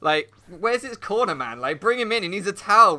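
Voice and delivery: rally a beaten fighter in mock urgency; mock cornering. Onlooker calls for pigeon's corner man, loud mock rallying.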